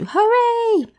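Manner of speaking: high, loud hooray; cheering. Retold or imitated. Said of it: "在庆祝".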